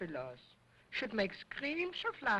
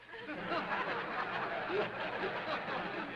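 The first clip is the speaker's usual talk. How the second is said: lecherous puppet chuckle; 6 semitones higher than usual. Acted. Dummy laughs at own joke.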